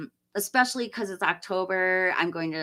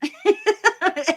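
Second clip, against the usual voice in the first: high laugh; 12 semitones higher, 5 dB louder than usual. Real, not acted. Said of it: "It's so funny".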